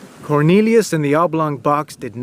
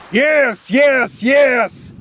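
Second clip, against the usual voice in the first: high, wild repeated shout; Cornelius shouting; 10 semitones higher, much slower than usual. Acted. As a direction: scream yes like a madman. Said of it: "Cornelius shouted".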